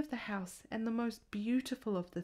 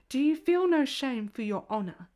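indignant scolding; 3 semitones higher, 7 dB louder than usual. A narrator's reading. Woman scolds the porter.

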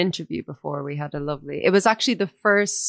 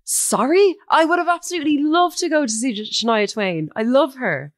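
higher, gushing mock-apologetic plea; mock apology; 7 semitones higher than usual. Retelling her reaction to the missed gig, mock gushing apology. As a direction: play it as an over-the-top sorry to the story. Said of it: "And I was like".

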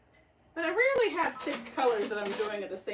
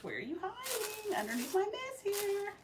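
talking to scissors; 9 semitones higher, 8 dB quieter than usual. Real, not acted.

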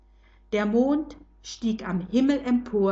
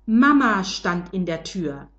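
ordinary delivery, nothing marked

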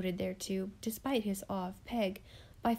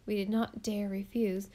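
usual speaking voice

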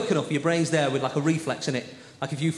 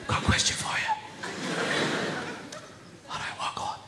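whispering; 9 dB quieter than usual. Pretend. Brain whispering questions at bedtime.

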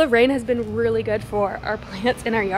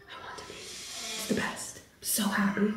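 breathy near-whisper, softer; hushed happy; 4 semitones lower, 13 dB quieter than usual. Real, not acted. Vlogger murmuring her joy to the camera, almost whispered. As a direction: confide your happiness under your breath.

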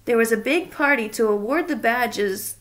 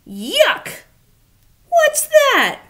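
much higher, loud yuck; a grouch recoiling at a cupcake; hammed-up disgust; 12 semitones higher, 6 dB louder, much slower than usual. Oscar disgusted.

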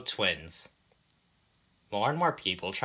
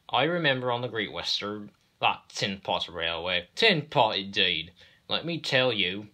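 put-on boastful old engine; 2 semitones higher, 5 dB louder than usual. Engine boasting to the others about railways, scornful. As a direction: reminisce with emphatic disdain.

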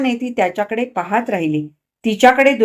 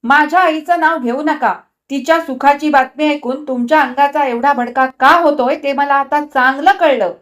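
louder, higher, furious; trembling with anger; 5 semitones higher, 5 dB louder than usual. A narrator's reading. Wife defends her mother, trembling with anger. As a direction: shake with rage on every word. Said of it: "रागाने थरथरत तिने म्हटले".

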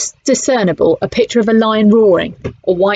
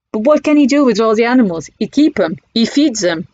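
put-on French accent; 4 semitones higher than usual. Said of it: "in broken English".

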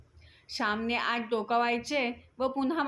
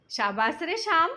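higher, hearty well done; lifted, encouraging call; cheering praise; 6 semitones higher, 4 dB louder than usual. A narrator's reading. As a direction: cheer on a young learner. Coach praises the boy's jump.

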